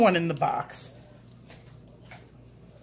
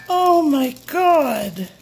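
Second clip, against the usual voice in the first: loud, slow, higher cry of amazement; overwhelmed exclamation; 4 semitones higher, 8 dB louder, much slower than usual. Real, not acted. Unboxer finding another package, overwhelmed exclamation. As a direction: stretch it out loud in disbelief.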